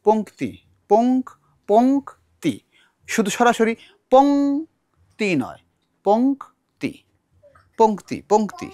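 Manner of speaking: পংক্তি drawn out with the k said clearly, poŋkti; পংক্তি said slowly as poŋkti with the k sounded; slow careful demo. Shown as a demonstration. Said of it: "একটা ক আছে".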